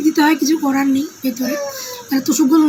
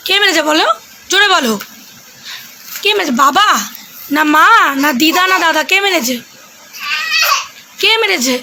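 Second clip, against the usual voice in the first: put-on coaxing a child; 3 semitones higher than usual. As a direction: playfully quiz the child, bright and coaxing. Keeps quizzing child on camera.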